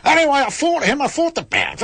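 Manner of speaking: a hammed-up, over-the-top Australian accent. Host reading a listener's letter to radio listeners, in a bad Australian accent. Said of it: "I think I did my best".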